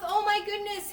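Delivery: jaw-dropped amazement; mock shocked. Shown as a demonstration. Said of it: "Open your mouth".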